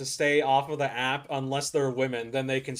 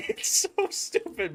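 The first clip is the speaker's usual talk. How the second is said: high, slow words through laughter; laughing; slower than usual. Real, not acted. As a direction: laugh while you say it. Cracking up at the hearing.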